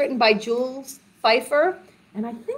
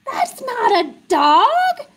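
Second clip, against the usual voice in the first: slow, high disbelief; playfully scandalized; mock astonishment; 10 semitones higher, 4 dB louder, slower than usual. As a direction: draw it out, hugely puzzled. Mock shock at wrong animal sound.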